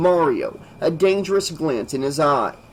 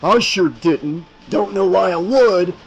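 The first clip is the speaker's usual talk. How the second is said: angry snarl; 4 semitones higher, 5 dB louder than usual. Acted. Mario continuing snarl.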